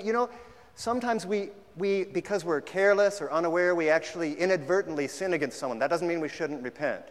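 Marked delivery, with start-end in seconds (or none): none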